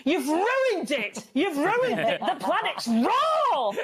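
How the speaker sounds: high-pitched mock celebrity chef rant, slowed; Gordon Ramsay yelling. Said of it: "Gordon Ramsay screaming at us".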